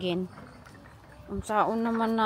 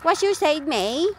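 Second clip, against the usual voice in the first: put-on echoing daughter in her own accent; playful imitation of a kid's accent; Jessica's accent; 3 semitones higher than usual. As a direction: try out your child's accent, pitched up. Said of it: "Is the accent very good?".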